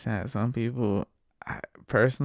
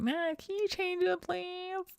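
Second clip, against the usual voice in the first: very high, soft mock plea; lady passenger; 5 dB quieter, slower than usual. Lady begging driver to switch songs, high imitated plea. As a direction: beg in a squeaky imitated woman's voice. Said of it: "then she's just like".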